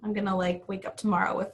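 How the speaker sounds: usual speaking voice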